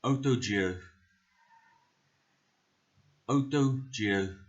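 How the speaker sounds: slow, careful South African accent. Shown as a demonstration. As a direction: teach each word in a South African accent. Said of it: "We are doing this in a South African accent".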